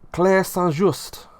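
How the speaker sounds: slow, drawn-out mock French accent on the name; terrible French accent. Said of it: "that's my terrible French accent again".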